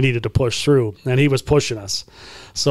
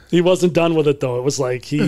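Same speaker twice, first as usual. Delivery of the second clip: ordinary talk, nothing marked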